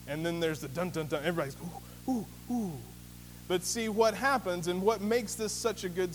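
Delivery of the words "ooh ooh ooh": soft, hushed oohs; preacher imitating a hushed crowd; awed crowd oohs. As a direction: mimic a crowd drawing breath in awe. Crowd reacts to the reveal.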